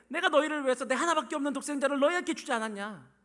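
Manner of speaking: loud, high, insistent reply; impassioned rebuttal. Acted. God answering doubting Israel about his only son, emphatic. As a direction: answer the accusation with full force.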